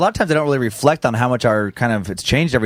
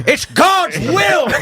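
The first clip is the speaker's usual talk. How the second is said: high, drawn-out shout; mock zealous cry; 14 semitones higher, much slower than usual. Acting out a bit in the box, mock zealous shout.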